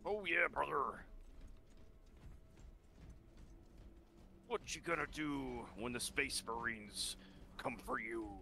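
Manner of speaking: gruff hyped wrestling promo; wrestler promo voice. Retold or imitated. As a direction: growl it like a pro wrestler. Wrestler-style parody.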